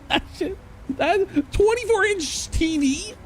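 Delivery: high, squeaky voice through real laughter; helpless laughter pushing the voice way up; laughing in disbelief. Real, not acted. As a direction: barely get it out for laughing. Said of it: "Sorry, I'm laughing at you".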